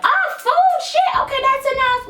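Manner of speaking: high squeaky belly voice; a stomach that suddenly gives up; talking belly. Pretend. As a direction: give the belly a high cartoon voice. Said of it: "your belly's just like".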